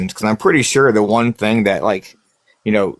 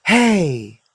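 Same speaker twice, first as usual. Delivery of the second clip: high sharp call of a ghostly woman; loud female call; 8 semitones higher than usual. Retold or imitated. A ghost woman calling out to him. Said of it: "this loud female goes".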